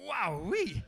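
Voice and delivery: put-on high, loud, wide-eyed kid voice; amazed kid. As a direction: act dazzled by a bottle of Lilt. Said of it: "you'd be like".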